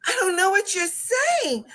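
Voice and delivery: high, pleading retold reply; exasperated bafflement. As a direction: admit helplessly you can't understand. Baffled reply to upset student.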